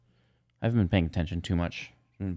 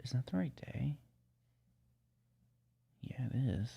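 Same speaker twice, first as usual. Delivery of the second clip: whispering; 3 semitones higher, 12 dB quieter than usual. Real, not acted. Whispered to self.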